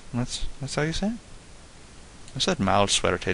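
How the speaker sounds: normal talking voice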